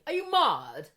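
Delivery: posh lady. Retold or imitated. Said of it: "this woman was so posh".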